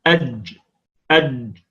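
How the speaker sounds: أج with a voiced j; teacher sounding out jim; jim sound demo. Shown as a demonstration. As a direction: buzz the j in أج. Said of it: "والجيم هكذا".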